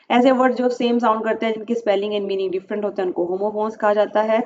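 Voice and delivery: ordinary delivery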